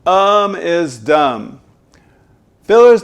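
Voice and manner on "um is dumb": very slow, deliberate; slow punchline. Shown as a demonstration. Coach stating a memorable rule slowly. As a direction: land each word slowly. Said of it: "Think of it like this".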